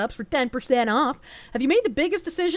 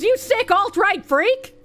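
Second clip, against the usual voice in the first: high, slow, hammed-up indignation; mock outrage; 8 semitones higher, 4 dB louder, slower than usual. Streamer reads a donor's joke insult aloud, mock outrage. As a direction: draw out the insult in mock fury.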